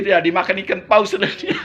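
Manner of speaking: ordinary talk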